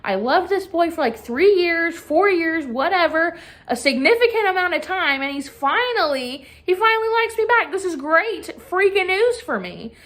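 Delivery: put-on high, breathless gushing teen crush; mock-giddy girl thrilled her crush likes her back; giddy excitement. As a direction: squeal it out like a lovestruck freshman. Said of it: "and she's like".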